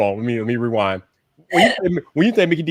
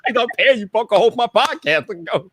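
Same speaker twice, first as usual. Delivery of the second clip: higher-pitched mock boss; bossy employer; 9 semitones higher than usual. Boss ordering his unpaid employee to co-host, mock bossy. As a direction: play a boss ordering staff about. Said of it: "he's the boss".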